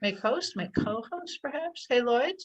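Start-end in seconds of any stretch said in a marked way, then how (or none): none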